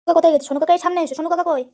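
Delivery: usual speaking voice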